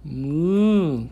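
put-on high playful moo for a child; cow moo. Shown as a demonstration. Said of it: "What does the cow say?".

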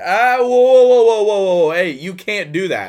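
loud, high alarmed calls to stop him; calling out whoa. Retold or imitated. Shift lead shouting at a tripping guest to stop, loud alarmed call. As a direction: yell whoa to halt a guy mid-jump. Stopping a guest hopping over fire.

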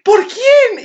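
high, loud excited ask; eager question. Retold or imitated. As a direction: pounce on the question with curiosity. Amigas preguntando emocionadas. (pregunta emocionada y aguda)

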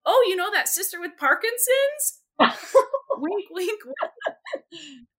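hint heavily, wink in the voice; knowing tease. Retold or imitated. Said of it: "And I was like".

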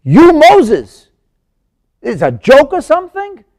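very loud, high, stretched "you"; upset, incredulous. Acted. Pharaoh upset that Moses is the speaker, loud and incredulous. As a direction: hurl each question in scornful disbelief. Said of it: "He's upset that you".

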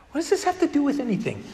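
high-pitched, puzzled objection; mock-indignant, pitch jumping way up; baffled protest. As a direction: act out being thrown by an odd question. Retold baffled reply to friend.

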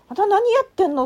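loud, high scolding retold from the teacher; shouted rebuke, high and loud; angry scolding. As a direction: yell it like a teacher who's fed up. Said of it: "むっちゃ怒られました".

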